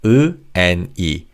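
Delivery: 으 and 이 as two distinct vowel sounds; two separate vowels. Shown as a demonstration. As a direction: sound out 으, then 이, each on its own. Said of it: "two vowel sounds".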